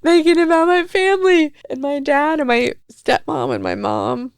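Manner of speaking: high, teary voice breaking through laughter; real tearful crying. Real, not acted. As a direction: cry through the words, half laughing. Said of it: "I am sitting here watching this clip crying again".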